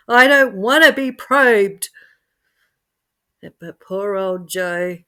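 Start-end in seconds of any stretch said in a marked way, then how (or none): 0.1-1.9 higher and louder, pleading; desperate scream. Acted. Joe about to be probed, screaming in dread. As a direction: plead loudly in terror. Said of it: "Joe screamed for all he was worth".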